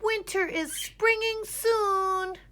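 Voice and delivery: very high, very loud, very slow shout; sleepwalking boy shouting. A narrator's reading. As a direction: bellow it to the world. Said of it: "he shouted".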